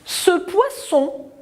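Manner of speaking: Ce poisson read slowly, pitched high, hissing ss; careful reading. Shown as a demonstration. Teacher reading slowly so the children hear poisson. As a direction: stretch out poisson with a sharp s. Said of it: "Deuxième phrase".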